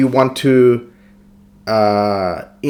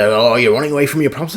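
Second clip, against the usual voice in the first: quick, quieter put-on taunt; mocking accusation; 3 semitones lower, 5 dB quieter, faster than usual. Critic accusing the player of running away, mocking imitation. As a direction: mimic a dismissive critic, fast and soft.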